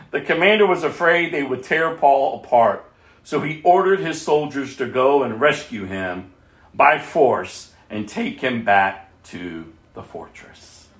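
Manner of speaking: normal talking voice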